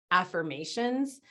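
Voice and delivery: ordinary talk, nothing marked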